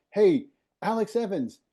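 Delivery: high, loud call across the room; imitating a passer-by shouting out a name; retold yell. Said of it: "just yells out".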